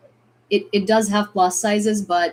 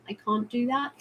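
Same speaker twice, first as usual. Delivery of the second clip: soft English accent with broad "can't"; 3 semitones higher, 5 dB quieter than usual. Shown as a demonstration. Showing what classmates found funny, in an English accent. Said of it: "I had a quite English accent".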